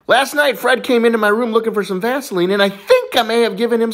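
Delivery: put-on high childlike confession; little boy. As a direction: blurt it to Mom in a kid's high voice. Plays little boy telling Mom.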